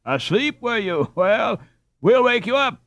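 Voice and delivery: rising, high sarcastic jeer; mocking. A narrator's reading. Lambert taunting the captured robber, mocking.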